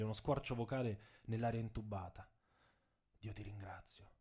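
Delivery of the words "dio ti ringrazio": barely audible, breath-thin thanks; faint thread of voice. A narrator's reading. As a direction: whisper the thanks to God, spent. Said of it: "con un filo di voce".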